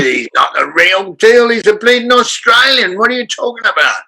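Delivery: high-pitched indignant Cockney; Bob Hoskins. Retold or imitated. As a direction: protest in a mock-outraged Cockney voice. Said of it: "He said".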